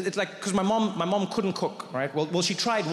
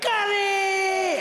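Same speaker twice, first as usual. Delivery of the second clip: high, drawn-out mimicked scream; top-of-lungs scream; 14 semitones higher, 4 dB louder than usual. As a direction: let out one long, piercing cry. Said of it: "he'd scream at the top of his lungs".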